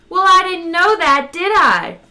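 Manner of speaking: loud, high-pitched protest; defensive retort. A narrator's reading. Zaphod defends himself to Ford, loud and high.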